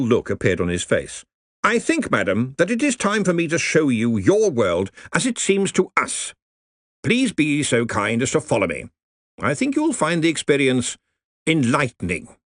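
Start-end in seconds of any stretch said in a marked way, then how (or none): 1.6-12.4 hearty old wizard, lightly voiced. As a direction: speak like a pompous old don. Ridcully invites her.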